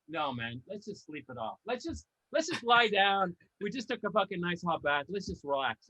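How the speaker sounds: dozy leaders mumbling about a nap. Pretend. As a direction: sound too relaxed to start a war. Drowsy leaders after hot bath.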